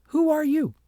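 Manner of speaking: high, loud echo of Agnes; mocking echo. A narrator's reading. The ugly child mimics Agnes word for word, high and loud. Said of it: "And the little girl said".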